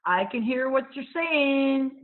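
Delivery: loud, slow hollered call; slow defiant holler to the boys behind. Acted. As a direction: yell it back at the boys, drawn out. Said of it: "I hollered back at them".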